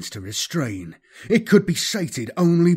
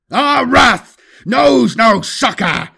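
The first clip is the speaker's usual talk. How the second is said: slow, loud roaring war cry; battle cry; 9 semitones higher, 7 dB louder, slower than usual. Acted. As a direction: roar each word at the enemy. Battle cry while charging.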